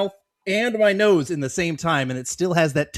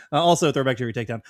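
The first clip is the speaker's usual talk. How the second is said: normal talking voice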